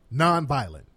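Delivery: usual speaking voice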